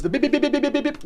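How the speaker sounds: high beep beep beep; imitated beeps. Vlogger showing the beep from the song, high quick beeps. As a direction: lightly mimic the song's beeping. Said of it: "the noise".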